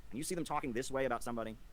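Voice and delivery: ordinary talk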